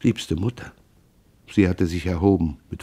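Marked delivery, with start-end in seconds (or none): 0.0-0.7 much softer, barely voiced schweig; kneeling son whispers to his mother to stop, hushed; whispered plea. A narrator's reading. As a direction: whisper it on your knees, pleading. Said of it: "flüsterte".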